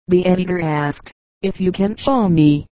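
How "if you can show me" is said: lightly voiced scoffing dare; a mild sneer of disbelief; sarcastic. A narrator's reading. Businessman scoffing at the editor, mildly sarcastic. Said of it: "was the sarcastic answer".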